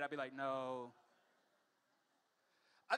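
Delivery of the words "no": flat no. Retold or imitated. His deadpan reply.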